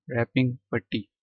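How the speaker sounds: normal talking voice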